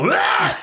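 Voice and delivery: high mock vomit noise; retching dog imitation. Storyteller imitating his dog being sick, mock retch. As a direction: heave out one sudden retch.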